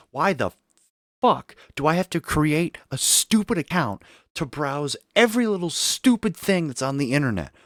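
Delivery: loud, high, furious ranting; angry rant. Real, not acted. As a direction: rant it out at full volume. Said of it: "Okay, rant over".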